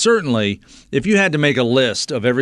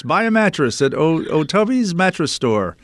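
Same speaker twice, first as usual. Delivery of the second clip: fading mock Irish accent; 6 semitones higher than usual. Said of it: "you're just talking like this".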